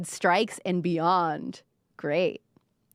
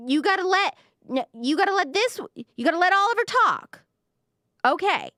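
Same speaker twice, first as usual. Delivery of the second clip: mock hall monitor; 8 semitones higher than usual. Mock refereeing the podcast hosts.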